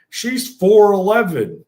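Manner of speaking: slow, loud, high disbelief; incredulous exclaim. Real, not acted. Telling the podcast how mom beat him, slow and loud. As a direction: stretch it out in amazement. Exclaiming about his mother's beating.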